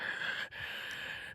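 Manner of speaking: a soft, breathless mock gasp down the phone; imitated gasp. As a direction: gasp like you're too freaked to speak. Friend gasps on the phone.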